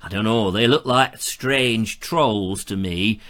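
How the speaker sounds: put-on northern English accent. Presenter reading out a viewer's reasons to go north, northern English accent. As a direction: play it as a chatty northern English lad's guide, accent and all. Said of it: "gone into a northern accent".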